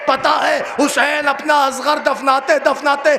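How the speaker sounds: soaring, slowed wailing climax of a majlis reciter; پرجوش مرثیہ خوانی کی چیخ; full-force lament preaching. Acted. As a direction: drive the grief up to a shout, drawing the words out.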